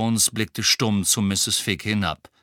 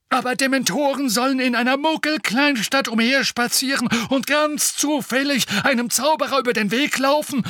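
snorting; 5 dB louder than usual. Acted. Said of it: "schnaubte Fudge".

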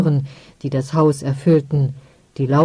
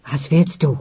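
venomous. Acted. Ostwan snapping at his mother, mild venom. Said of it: "giftete er".

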